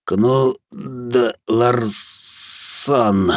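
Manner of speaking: labored, halting croak; hoarse croak. A narrator's reading. Captive gives his name under hypnosis, hoarse and halting. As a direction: force the name out with effort. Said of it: "прохрипел пленник".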